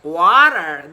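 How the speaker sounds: American "water" with a rolled R; stretched American pronunciation of "water"; American accent. Shown as a demonstration. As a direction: say "water" the American way, drawn out and loud. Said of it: "That's what an American would say".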